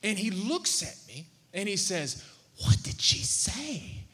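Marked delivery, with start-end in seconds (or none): 2.6-4.0 put-on slow whispered child's question. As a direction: whisper it like a curious little boy. Little son asking on plane.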